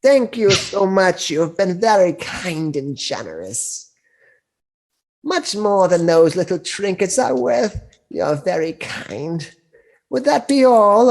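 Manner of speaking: put-on high, slow sweet old crone; a kindly old hag selling her wares.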